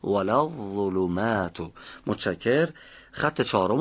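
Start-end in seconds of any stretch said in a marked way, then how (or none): 0.0-1.7 ولا الظلمات with a heavy ظ; model phrase. Shown as a demonstration. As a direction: echo the student correctly. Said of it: "آفرین".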